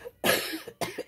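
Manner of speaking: dry unvoiced cough; coughing fit. Real, not acted. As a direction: clear the throat with a cough. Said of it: "je tousse".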